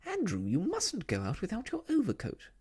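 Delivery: high, fussy scolding call; doting owner calling out in a high voice; Miss Lark calling. A narrator's reading. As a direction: call out a fretful warning, swooping high. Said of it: "in a very loud voice".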